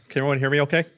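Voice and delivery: ordinary delivery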